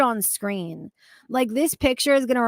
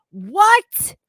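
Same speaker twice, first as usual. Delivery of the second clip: loud, high outraged rise; high, loud indignant what; incredulous what; 8 semitones higher, 7 dB louder than usual. Real, not acted. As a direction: burst out in disbelief. Outraged reaction to coworker.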